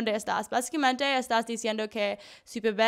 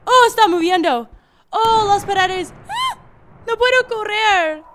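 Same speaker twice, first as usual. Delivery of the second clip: high, loud frantic cries while fleeing; panicked screaming; 10 semitones higher, 11 dB louder than usual. Real, not acted. Gamer fleeing moving walls, panicked high yelling.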